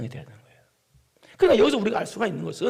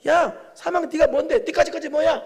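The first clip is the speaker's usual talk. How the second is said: higher, louder, sneering at death; mocking taunt; 8 semitones higher, 7 dB louder, slower than usual. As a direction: taunt death like a schoolyard bully. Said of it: "사망을 조롱하는 거예요".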